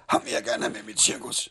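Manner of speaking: a breathy, half-shouted mock voice of the old showman; lightly voiced gruff circus boss, calling out; imitating the professor. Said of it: "han havde sådan en sjov stemme". (let efterlignet cirkusdirektør, halvt råbende)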